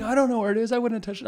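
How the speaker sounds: put-on high whiny kid denial; kids denying. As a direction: play the kids swearing they never touched it. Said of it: "And they're like".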